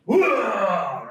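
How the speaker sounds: sudden high, loud whoa; startled shout. Retold or imitated. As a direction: blurt it out in shock. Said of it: "and they go".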